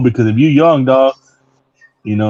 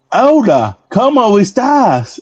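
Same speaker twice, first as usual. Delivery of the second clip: mimicking the greeter; 8 semitones higher, much slower than usual. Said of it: "the fucking greeter first thing always tell me".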